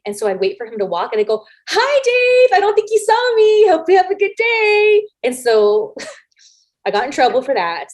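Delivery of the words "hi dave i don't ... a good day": loud, high singsong hello; pointed cheery greeting. Retold or imitated. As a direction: belt out an over-friendly greeting to someone who snubbed you. Pointed greeting after being snubbed.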